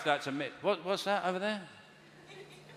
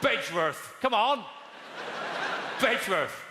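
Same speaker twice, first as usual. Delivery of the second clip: a hint of a rowdy local pressing the point; mock heckler; 5 semitones higher than usual. Comedian mimicking a heckler, mildly pushy. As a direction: shout the village name at the stage, lightly voiced. Heckler shouting village names.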